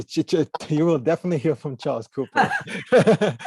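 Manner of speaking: real laughter breaking through the words; laughing. Real, not acted. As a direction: chuckle your way through the answer. Laughing while answering the request.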